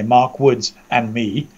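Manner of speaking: ordinary talk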